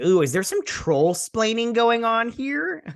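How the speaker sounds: high, teasing mock curiosity; high-pitched playful needling; mock intrigued teasing. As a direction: tease with an exaggerated rising "ooh". Mocking a character's condescending explanation.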